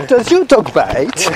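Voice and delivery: slow mock French Clouseau accent. Greeting a dog walker in a mock French Clouseau accent. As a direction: drawl it like a hammed-up French Clouseau. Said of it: "in my best Clouseau accent".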